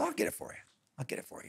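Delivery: hushed retold reassurance; soft offer. Storyteller offers to buy cyclist a mattress, retold softly. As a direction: drop the volume right down, as an aside.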